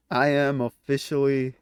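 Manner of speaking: normal talking voice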